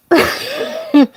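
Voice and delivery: a sudden high squeal of a laugh; high embarrassed giggle; high squeaky laugh. Real, not acted. Laughs at being weird.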